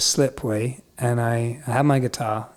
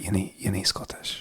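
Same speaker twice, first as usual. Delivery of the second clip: softly imitated Scottish put-down; Scottish accent; 5 semitones lower, 10 dB quieter than usual. Old schoolmates doubting him, in a soft Scottish accent. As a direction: say it low in a Scots accent. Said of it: "people I went to school with".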